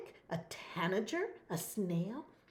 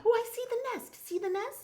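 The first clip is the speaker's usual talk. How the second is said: excited; 12 semitones higher, faster than usual. Real, not acted. Finds nest in picture.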